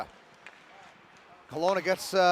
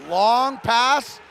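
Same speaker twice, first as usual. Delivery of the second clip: raised, loud burst of play-by-play; excited call; 5 semitones higher, 7 dB louder than usual. Real, not acted. Commentator spots a long pass up ice, excited. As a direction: punch it out high and loud.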